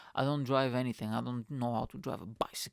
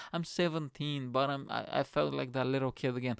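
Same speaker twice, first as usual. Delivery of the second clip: ordinary delivery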